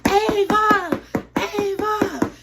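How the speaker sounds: high, loud drawn-out holler; re-enacted high-pitched name-calling; screaming a name. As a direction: scream for a friend through a shut door. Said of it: "We screaming her name".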